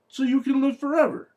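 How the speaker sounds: high-pitched, incredulous repeat of the line; exasperated echo. Real, not acted. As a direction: throw the line back in high disbelief.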